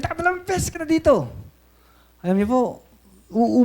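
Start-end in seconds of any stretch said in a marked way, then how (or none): 0.0-1.3 imitated scolding. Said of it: "Sabi sa akin".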